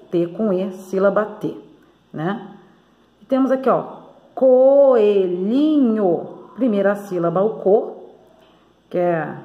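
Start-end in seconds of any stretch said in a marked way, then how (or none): 4.3-6.3 coelhinho stretched very slowly as co-e-lhi-nho; syllable by syllable. Shown as a demonstration. Teacher splitting a word for pupils, coelhinho in long separate syllables. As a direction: draw out each syllable of coelhinho, loud and slow. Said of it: "Tô falando separando".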